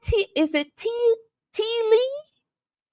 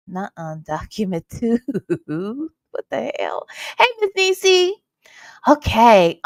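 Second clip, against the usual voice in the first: ordinary talk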